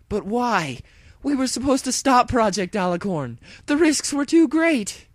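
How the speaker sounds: high, very loud, pleading pony agent; hero voice, desperate. Acted. Dash to brainwashed partner, loud and pleading. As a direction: beg your old partner to explain.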